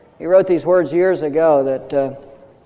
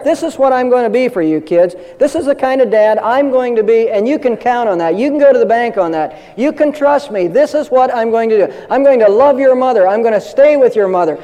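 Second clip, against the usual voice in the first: raised, resolute father's vow; firm declaration; 7 semitones higher than usual. Shown as a demonstration. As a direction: proclaim it like a solemn promise. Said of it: "Fully declare it".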